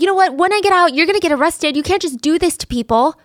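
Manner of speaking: angry threat. Retold or imitated. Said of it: "he gets angry and he's like".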